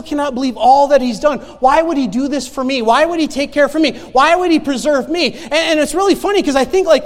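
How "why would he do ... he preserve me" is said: preaching at full force. Acted. Preaching climax.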